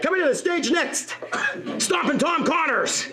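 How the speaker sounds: mock-epic stage introduction; concert announcer. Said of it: "it sounded like a rock concert".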